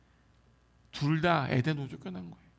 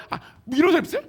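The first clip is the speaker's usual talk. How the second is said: ordinary talk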